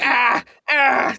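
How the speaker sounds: high, startled mock screams; imitated frightened yelping; mock panicked yelps. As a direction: yelp as if cornered, hammed up. Acts friend panicking in shower.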